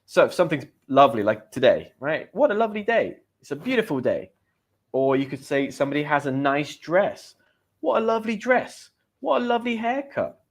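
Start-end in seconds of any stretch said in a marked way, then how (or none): 2.3-3.2 higher-pitched delighted exclamation; acted admiration, pitched up; admiring exclamation. Shown as a demonstration. Example exclamation of praise. 7.8-10.4 high-pitched delighted compliments; acted admiring exclamation. Shown as a demonstration.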